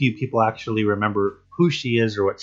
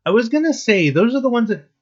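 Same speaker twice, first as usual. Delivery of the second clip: excited; 13 semitones higher, 4 dB louder than usual. Real, not acted. Eager to answer chat about runts.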